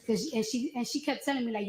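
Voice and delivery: ordinary talk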